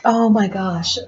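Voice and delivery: ordinary delivery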